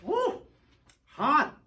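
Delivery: real startled cry of heat; real surprised yelp. Real, not acted. Bites hot candy.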